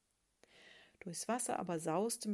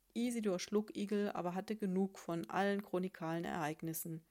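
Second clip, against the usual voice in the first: usual speaking voice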